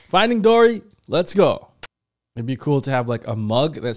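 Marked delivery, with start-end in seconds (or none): 0.1-1.7 high, loud hype call to start the movie; excited. Real, not acted. Host kicking off a reaction for his viewers, loud excited cheer. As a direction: fire up the viewers, much higher and louder.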